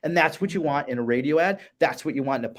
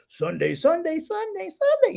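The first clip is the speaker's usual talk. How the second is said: radio ad announcer; slower than usual. Retold or imitated. Cohost imitating a radio commercial, booming announcer hype. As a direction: hype it like a Sunday Sunday Sunday radio spot. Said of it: "you'll hear that too with radio commercials".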